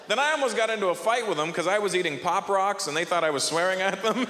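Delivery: ordinary talk, nothing marked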